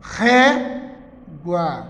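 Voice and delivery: "Régua" split into ré, pause, gua; syllable by syllable. Shown as a demonstration. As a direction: separate "Régua" into two slow syllables. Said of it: "A separação". ("Régua" separado em sílabas: ré - gua)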